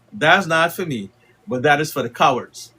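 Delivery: ordinary delivery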